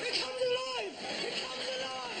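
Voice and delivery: high, hammed-up shout of amazement; squealing high mock alarm; mock drunk shout. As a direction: yell it like your beer just woke up. Drunk man shouting at beer.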